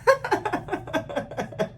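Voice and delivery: high, voiceless laugh; long, wheezy string of laughs; laughter. Real, not acted. Reactor cracking up at a dragon video, high laughter.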